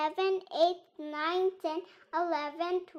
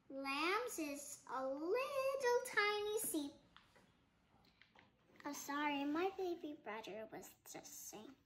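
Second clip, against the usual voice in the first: usual speaking voice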